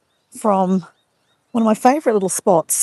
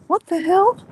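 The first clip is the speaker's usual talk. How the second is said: high-pitched imitation of a startled reaction; mock bewilderment; 11 semitones higher than usual. Host imitating Diego freaking out at the bun, mock bewilderment. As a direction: squeak it as a baffled onlooker.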